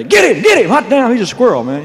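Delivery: high, loud yelling crowd; heckling crowd shouting at his sideburns; mock mob shout. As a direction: holler like a mob chasing him. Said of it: "The sideburn and the hair, they".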